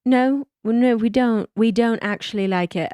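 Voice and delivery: imitated British reply, politely disagreeing; hesitant British contradiction, mock-polite; British accent. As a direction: answer in a put-on British accent. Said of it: "They have to ask questions".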